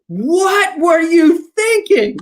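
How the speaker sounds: very high, slow, stretched disbelief; incredulous. Retold or imitated. Teller on the phone to Mike, slow incredulous cry. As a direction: drag it out in amazed disbelief.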